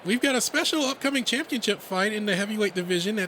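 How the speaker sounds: high rabbit emcee voice; timid announcer. Acted. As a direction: announce the big fight to a crowd.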